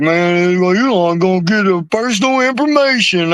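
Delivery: high, whiny mock of scared people; mocking the worriers. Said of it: "They're all like".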